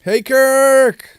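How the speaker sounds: big, high shout of a hello; loud greeting. Real, not acted. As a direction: call out a hearty welcome. Greeting new producer loudly.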